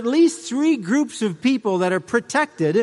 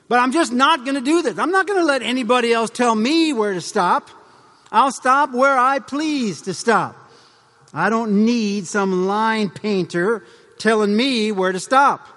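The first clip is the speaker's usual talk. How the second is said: higher, emphatic mock rebel protest; acted defiance; 4 semitones higher, 4 dB louder than usual. Voicing a rebel against stop lines, loudly defiant. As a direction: dig in stubbornly as a rebel driver.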